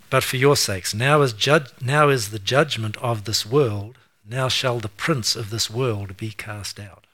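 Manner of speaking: loud, slow, punching each now; emphatic scripture reading. Acted. Preacher rereading judgment verse to congregation, slow and loud. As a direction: declare it like a verdict.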